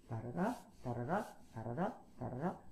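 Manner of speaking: '다라라'의 마지막 '라'를 올려서; '다라라'를 매번 끝이 올라가게 반복; 끝을 올리는 리듬. Shown as a demonstration. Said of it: "모든 말의 끝을 올리는 것이". ('다라라' 끝음 올리기)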